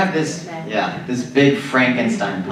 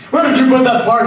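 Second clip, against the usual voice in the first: much higher and louder frantic scientist; mad scientist; 13 semitones higher, 7 dB louder than usual. Acted. Mad scientist calling to his monster, high and loud. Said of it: "when you're the mad scientist".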